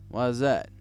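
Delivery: ordinary delivery, nothing marked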